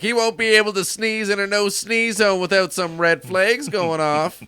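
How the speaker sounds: high, loud mock cop; stern cop voice. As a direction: bark it like a strict cop. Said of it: "She's like".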